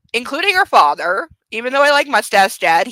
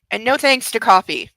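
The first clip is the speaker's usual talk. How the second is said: normal talking voice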